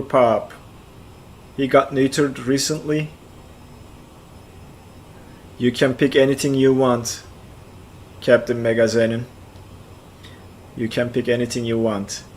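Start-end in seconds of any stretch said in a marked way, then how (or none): none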